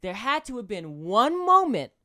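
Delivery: loud, slow, pressing cop grilling a suspect; mock cop interrogation. Cop grilling the suspect about the movie, loud and slow.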